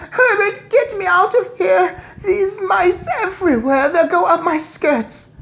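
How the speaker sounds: very high, loud shrill shriek; shrill screaming. Acted. Missus Jenkins begging her husband, shrill shriek. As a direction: shriek in panic at the mice. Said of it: "shrill voice".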